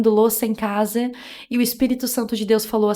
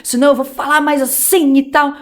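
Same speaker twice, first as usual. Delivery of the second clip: imitating the voice she used to put on, raised and louder; mock her old borrowed pulpit voice; put-on preacher voice; 5 semitones higher, 4 dB louder than usual. Woman imitating the voice she copied from someone else, mildly mocking. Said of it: "Aí eu mudava a minha voz".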